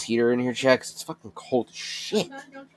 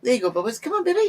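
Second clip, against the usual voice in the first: squeaky high pet praise; high coaxing; 10 semitones higher than usual. Real, not acted. Praising the cat coming out, squeaky high.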